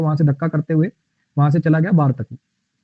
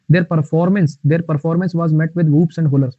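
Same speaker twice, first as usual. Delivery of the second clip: very slow, words spaced out; slow dictation; much slower than usual. Shown as a demonstration. Teacher reads the example sentence aloud, very slowly. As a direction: read it out carefully for the class.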